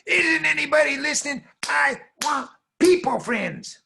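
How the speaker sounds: very high, loud tantrum; exasperated little girl. Acted. Girl explodes at the cow.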